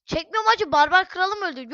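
high, loud protest; exasperated refusal. Real, not acted. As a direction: refuse loudly, upset at the loss. Refuses to record, his king died. (isyan eden, yüksek perdeden sitem)

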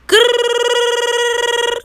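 high, loud mock growl; loud playful growl. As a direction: growl it out like a hype sign-off. Growled sign-off before gang.

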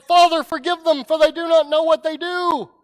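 loud, high cry to heaven; calling out. Acted. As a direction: lift it up like a cry from the cross. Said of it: "You can hear him call out".

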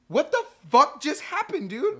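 stunned disbelief. Retold or imitated. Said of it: "I'm like".

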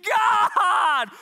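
high, loud burst of praise; joyful shout. Acted. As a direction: shout it out in joy. Daniel's joyful exclamation.

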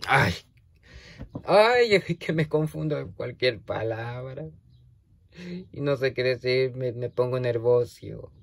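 normal talking voice

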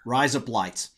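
"Rise up lights" at normal speed in a light Australian accent; tossed-off "Rise up lights" in a light Australian accent; mock Australian accent. Shown as a demonstration. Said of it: "with an Australian accent".

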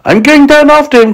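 high, determined cry. A narrator's reading. James going after the fallen centipede, crying out. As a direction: announce a rescue urgently. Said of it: "cried James".

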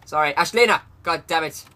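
ordinary talk, nothing marked

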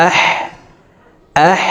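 আহ ending in a long breathy throat h; mid-throat ha. Shown as a demonstration. Teacher demonstrating ha, আহ with airy mid-throat h. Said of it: "হলকের মধ্যখান থেকে উচ্চারণ করে পড়ব".

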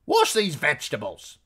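high, loud order from the innkeeper's wife; commanding. A narrator's reading. Wife to husband at the inn, loud command. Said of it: "she commanded".

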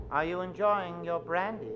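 ordinary talk

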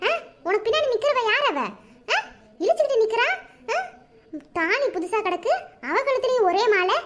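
high, rising shocked wife's voice. Acted. Wife confronting husband with new bride, shocked and high. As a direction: disbelief climbing as she sees the garland. Bakkiya finds husband garlanded.

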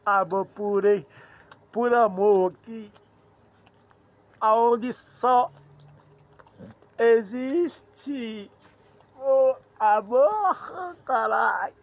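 very loud shout, stretched words; big drawn-out proclamation of love; exclaiming at full volume. Real, not acted. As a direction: bellow it out, drawing out the last words. Shouting about pure love.

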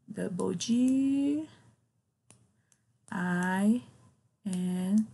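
slow, deliberate "Double G"; teacher slowly spelling the double g; spelled out slowly. Shown as a demonstration. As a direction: spell it out for the class. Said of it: "Faltou um G no finalzinho".

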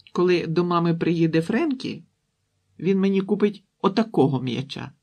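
put-on high, loud little-girl boast; boasting child. A narrator's reading. Girl boasts to sister about a promised ball, childish bragging. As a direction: show off to a sister.

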